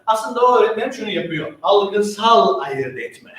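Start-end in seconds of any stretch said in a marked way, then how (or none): 1.6-3.3 Algısal ayırt etme, slow and deliberate; slow key term. Shown as a demonstration. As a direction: stretch each word out slowly. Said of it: "işte biz buna ne diyoruz?".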